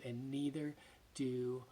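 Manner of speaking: fading to a hushed whisper; soft whisper. Acted. Jesus to the woman on the ground, a gentle whisper. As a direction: let it drop to a whisper. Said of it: "and he says".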